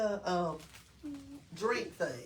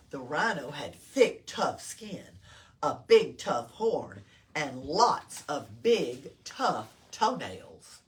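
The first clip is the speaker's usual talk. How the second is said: deep, slow, punchy list of tough things; chunky rhino-strong storytelling voice; low emphatic reading; 6 semitones lower, 5 dB louder than usual. A narrator's reading. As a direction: lean low and heavy on each tough.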